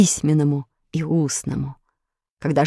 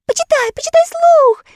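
very high, eager pleading; impatient excited; 5 dB louder than usual. A narrator's reading. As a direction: bounce with impatience. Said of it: "запрыгали от нетерпения".

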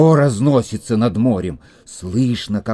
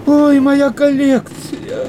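distressed wail; 12 semitones higher, 4 dB louder, slower than usual. Acted.

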